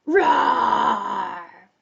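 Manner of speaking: big rough lion's roar. Pretend. Teacher shows children the story cue, big lion roar. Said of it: "when you hear lion".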